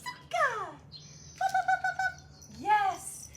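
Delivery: very high, loud "pup, pup" calls; excited puppy call. Real, not acted. Trainer calling the puppy back, delighted. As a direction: call her in with rising joy.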